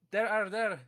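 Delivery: ordinary delivery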